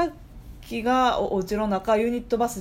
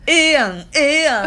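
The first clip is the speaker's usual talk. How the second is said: loud, higher "ええやん、ええやん" like a group's catchphrase; excited quote; 7 semitones higher, 7 dB louder than usual. Retold or imitated. Cohost mimics the group name as a loud, excited chant. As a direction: chant it like a Kansai boy band's hook. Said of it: "みたいな。".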